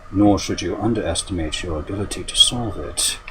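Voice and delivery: low, calm, measured commander voice; Thrawn. Acted. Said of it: "somewhat perfected my imitation of Thrawn".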